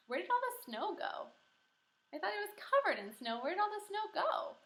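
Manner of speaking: high, pretend bafflement; teacher faking confusion over the missing snow; mock puzzled. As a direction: play dumb to get the kids thinking.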